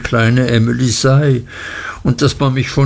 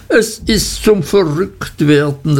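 höher, mit tränenerstickter Stimme; klagen, den Tränen nahe; weinerlicher Ausruf; 6 semitones higher than usual. A narrator's reading. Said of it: "rief meine Mutter". (verzweifelt weinerlich gerufen)

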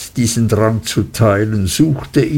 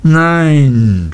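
drawn-out cry, higher in pitch; forceful cry; 3 semitones higher, 4 dB louder than usual. A narrator's reading. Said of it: "schrie Peggotty mit erstaunlicher Kraft in der Stimme".

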